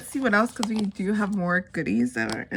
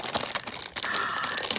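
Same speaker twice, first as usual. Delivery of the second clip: gasp; 5 dB quieter than usual. Real, not acted. Discovering stickers she wanted.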